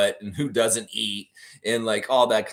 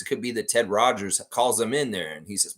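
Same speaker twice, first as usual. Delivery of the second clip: ordinary talk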